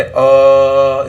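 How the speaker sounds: अ held long and loud; stretched vowel. Shown as a demonstration. Teacher showing a pulled vowel sound, drawn out. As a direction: stretch अ out on a level note. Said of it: "इसको जो खींचा है".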